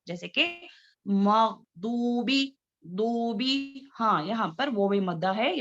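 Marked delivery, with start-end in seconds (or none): 1.1-3.9 मगदूबी with a heavy Arabic dwad, slow; slow correct recitation; dwad not dal. Shown as a demonstration. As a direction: stretch मगदूबी, dwad pressed against the side of the tongue. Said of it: "मोटे पढ़े जाने वाले".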